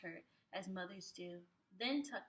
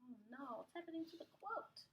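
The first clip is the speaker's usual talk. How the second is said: hushed, higher oh no; much softer, higher dismay; mock worried; 4 semitones higher, 10 dB quieter than usual. A narrator's reading. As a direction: gasp softly in worry. Reader worries about the quilt.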